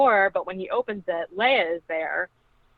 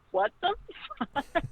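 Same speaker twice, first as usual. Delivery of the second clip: shocked disbelief; 12 semitones higher, 6 dB quieter than usual. Retold or imitated. Her own shocked reaction.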